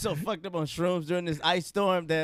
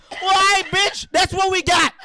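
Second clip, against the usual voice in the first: mock shout; 12 semitones higher, 6 dB louder than usual. Retelling the moment his friend hit the button, shouting. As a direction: bellow it out, laughing at him.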